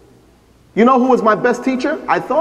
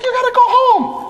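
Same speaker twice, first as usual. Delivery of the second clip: insistent stranger. Retold or imitated. Stranger telling him leave.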